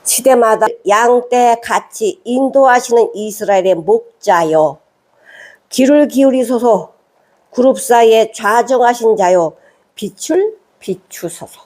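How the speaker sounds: measured, solemn reading of a psalm; scripture reading. Acted. (엄숙한 성경 낭독)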